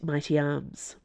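usual speaking voice

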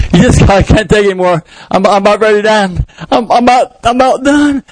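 high worn-out fighter voice; beaten fighter. Retold or imitated. Rocky at his limit, high worn-out cry.

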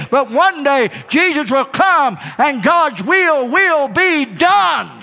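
high, driving pulpit proclamation; full-force preaching. Real, not acted. Preacher proclaiming Christ's kingdom to the congregation, fervent preaching. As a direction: preach it out with rising fervor.

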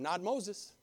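usual speaking voice

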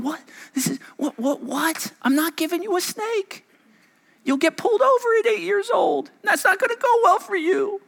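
put-on a baffled God refusing the child; imitating God's reply. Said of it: "God's going".

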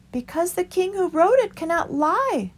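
meek small creature pleading for the way; Christopher. A narrator's reading.

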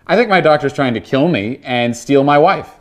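ordinary talk, nothing marked

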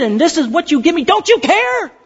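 high, indignant plea; accusing outburst. Acted. Believer who gave everything challenging God, accusing outburst. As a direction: build to a high-pitched accusation. Believer's acted complaint peaks.

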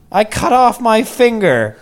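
high, slow mock child voice; plaintive kid announcing an injury, imitated; little boy. Six-year-old son telling his dad, little boy voice.

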